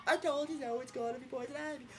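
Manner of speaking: high, far-off teasing voice; a high, smug told-you-so; mocking singsong. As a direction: tease him high and smug.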